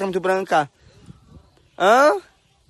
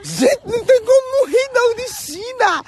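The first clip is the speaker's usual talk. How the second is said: laughing hard; 4 dB louder than usual. Real, not acted. Laughing at her friend.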